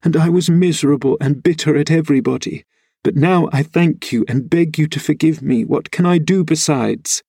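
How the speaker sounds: put-on high, plaintive girl's voice; pleading after crying, acted; tearful. A narrator's reading. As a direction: pour out a tearful apology. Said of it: "drying her eyes".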